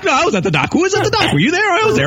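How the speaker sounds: a hint of a fifties film star; 1950s film actor. Mocking co-host with a hint of a fifties film voice. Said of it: "He sounds like an actor's in a nineteen fifty film".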